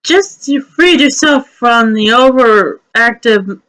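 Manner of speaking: ordinary talk, nothing marked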